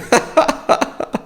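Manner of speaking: high-pitched burst of laughter. Real, not acted. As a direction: cackle at the absurdity. Laughs at Wes.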